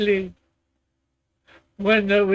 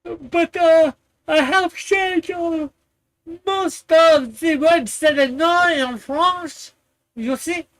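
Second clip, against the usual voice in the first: higher, halting mock French accent; fake French accent; 6 semitones higher than usual. Admitting his French words are used up, fake French accent. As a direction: ham up a pretend Frenchman, pitched up. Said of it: "I could put on, eh, the accent".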